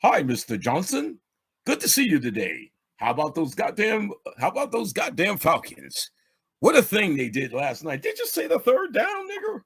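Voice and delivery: mock friendly coworker small talk; office voice. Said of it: "That's what you do in the office".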